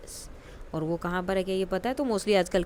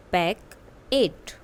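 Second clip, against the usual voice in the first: "Packet" drawn out, each syllable separate; slow it right down for the class; slow model word; 3 dB louder, much slower than usual. Shown as a demonstration. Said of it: "listen to your teacher and say the following word". (धीरे-धीरे, अलग-अलग अक्षर "packet")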